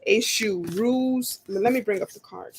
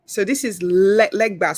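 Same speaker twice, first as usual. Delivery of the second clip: ordinary delivery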